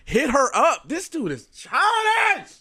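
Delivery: high, drawn-out exclamation of disbelief; incredulous high-pitched complaint; exasperated outburst. Real, not acted. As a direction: let the pitch soar and stretch it out in exasperation.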